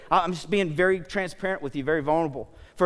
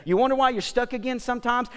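normal talking voice

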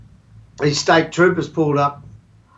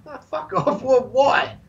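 mock disbelief; 9 semitones higher than usual. Boys answering the cops' order, high incredulous mock. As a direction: act baffled at the order. Boys' surprised reply to cops.

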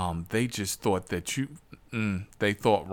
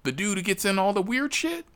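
surprised friend; 12 semitones higher than usual. Retold or imitated. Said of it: "he's like".

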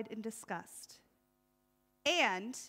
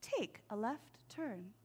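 gentle GPS voice; 2 semitones lower, 9 dB quieter, slower than usual. Pretend. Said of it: "his G P S will be".

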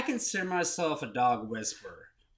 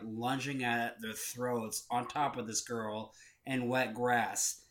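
ordinary talk

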